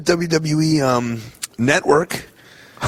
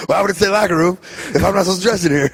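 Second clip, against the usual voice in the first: mock Steiner; 7 semitones higher, faster than usual. Voicing Steiner, laughing.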